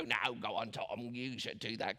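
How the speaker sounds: sly low whisper-ish tempter on the other shoulder; devil voice. Retold or imitated. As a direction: drop way down and coax like a cartoon devil. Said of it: "that's how the devil talks".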